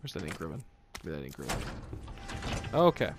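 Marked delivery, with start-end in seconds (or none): none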